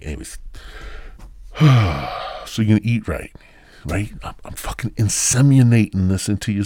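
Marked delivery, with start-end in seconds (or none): 0.4-2.4 long, audible exhale; heavy sigh. Real, not acted. Comic resets after his impression with a heavy sigh. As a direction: let out a big breath.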